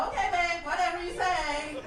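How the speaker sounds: high, loud mock agreement; hammed-up indulgent girlfriend; going along. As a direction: humour him in an exaggerated placating voice. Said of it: "you just go with it".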